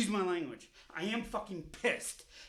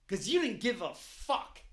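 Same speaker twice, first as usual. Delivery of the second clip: ordinary talk, nothing marked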